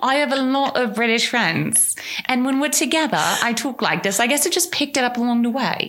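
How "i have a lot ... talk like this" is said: mock British accent for a dating excuse; playful British accent; put-on British accent. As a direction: casually explain in a fake British accent. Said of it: "don't I have a lovely British accent".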